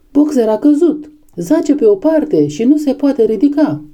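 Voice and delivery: high, alarmed announcement; urgent alarm. A narrator's reading. Pigeons rushing news of Boxer's fall to animals, urgent alarm. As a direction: deliver it as breaking bad news, pitched high.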